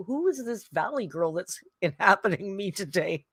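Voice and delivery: normal talking voice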